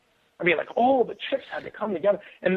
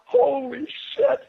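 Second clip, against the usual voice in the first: slow, higher, awed swear; stunned; 8 semitones higher, slower than usual. Retold or imitated. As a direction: let it out slowly, stunned by the storm. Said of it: "And I'm like".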